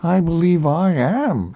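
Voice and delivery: a touch of a simple bear; Pooh. A narrator's reading. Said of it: "said Pooh".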